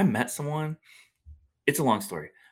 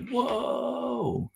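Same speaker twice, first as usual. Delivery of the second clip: awed whoa. Retold or imitated. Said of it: "I was like".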